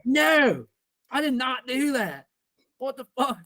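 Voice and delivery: high, drawn-out mock outrage; imitated indignation, pitch way up; indignant denial. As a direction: protest your innocence, loud "no" up top. Retold denial to principal.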